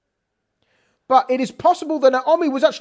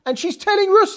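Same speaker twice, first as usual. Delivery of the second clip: ordinary delivery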